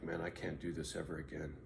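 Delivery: low, soft, beaten down; quiet despair. Retold or imitated. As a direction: say it like you're finally done. Retold moment of giving up.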